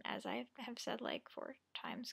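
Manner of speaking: hushed under-the-breath aside; whispered aside. Real, not acted. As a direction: whisper it to yourself. Self-mocking aside.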